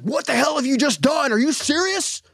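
high, exasperated angry father; mad dad. Retold or imitated. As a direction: pitch way up as a fed-up dad. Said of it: "He's mad".

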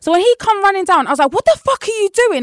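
high, loud retold outburst; angry. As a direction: relive the angry shout. Retelling yelling at boyfriend.

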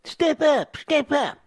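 high, sneering mimicry; mocking repeat. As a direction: throw his words back at him. Mocking the caller's words.

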